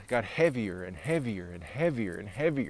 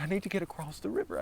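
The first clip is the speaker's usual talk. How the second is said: put-on high, fast, urgent child's plea; pleading child voice; 7 semitones higher, 4 dB quieter, faster than usual. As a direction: beg like a scared little kid. Lost child pleading to cross.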